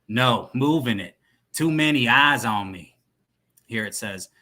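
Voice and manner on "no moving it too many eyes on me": put-on cowboy accent. Yellow phone explains the trouble to dealer, cowboy accent. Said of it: "He'll be like a cowboy guy".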